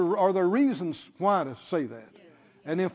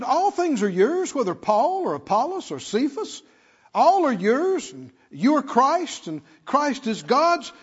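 raised, rhythmic preacher's list; ringing scripture reading; 6 semitones higher, 4 dB louder than usual. Real, not acted. Preacher reads out what is yours, ringing scripture reading. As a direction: lift each phrase of the verse.

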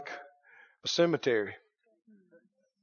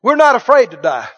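bold declaration; 3 semitones higher, 10 dB louder than usual. Real, not acted. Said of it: "I said we're not afraid".

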